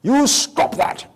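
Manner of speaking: put-on explosive high British-accented shout, slow; booming British telling-off, words drawn out; British father's scolding. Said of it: "with his British accent".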